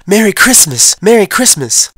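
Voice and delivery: high, loud happy yell; yelled greeting. Retold or imitated. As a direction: shout it out to the arriving neighbors. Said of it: "we run out and yelled".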